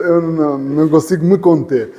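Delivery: usual speaking voice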